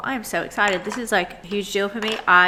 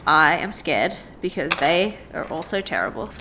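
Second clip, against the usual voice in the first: normal talking voice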